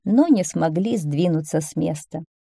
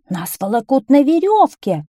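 raised, higher complaining cry; 7 semitones higher, 5 dB louder than usual. A narrator's reading. Whiners complaining to the policeman about being dragged, crying out. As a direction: call out in loud protest. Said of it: "кричали нытики".

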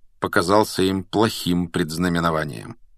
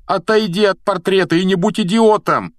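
loud, high-pitched angry order; heated, exasperated outburst; exploding in anger; 12 semitones higher, 6 dB louder than usual. A narrator's reading. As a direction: blow up at a stubborn friend. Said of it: "взорвался Рон".

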